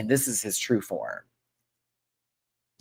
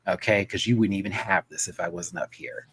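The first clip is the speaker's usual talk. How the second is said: ordinary talk, nothing marked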